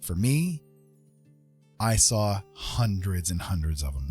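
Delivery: ordinary talk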